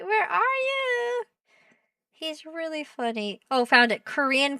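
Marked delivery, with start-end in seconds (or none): 0.0-1.2 high singsong call. Pretend. Streamer searching for a funny creator, calling high.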